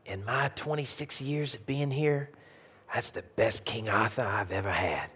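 soft, tearful Cajun teacher; Miss Billingsley. Retold or imitated. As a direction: praise quietly in a Cajun accent. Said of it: "as she wiped away tears".

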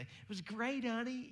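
high, bright fake praise to the wife; fake cheerful. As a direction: sell the lie with a high sweet voice. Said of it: "we lie and say".

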